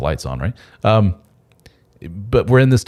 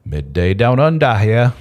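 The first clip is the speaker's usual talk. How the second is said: playful attempt at an Australian accent, pitched up at first; 5 semitones higher, 3 dB louder, slower than usual. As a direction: put on your best amateur Aussie accent. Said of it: "It's my best Australian accent".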